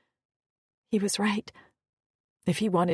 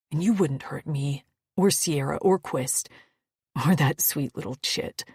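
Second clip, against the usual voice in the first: reassuring; 5 semitones lower than usual. Acted. Maddock soothing low.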